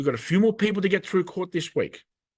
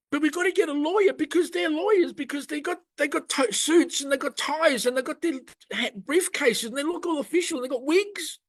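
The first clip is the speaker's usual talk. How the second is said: high, sing-song mockery of lawyers; mocking lawyer voice; 11 semitones higher than usual. Ranting to viewers, mocking lawyers in a high voice. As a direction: send up the lawyers in a silly high voice.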